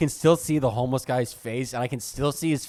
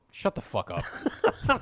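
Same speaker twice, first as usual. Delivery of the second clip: drawn-out mock dad; 7 semitones higher, much slower than usual. Dad snapping at clerk.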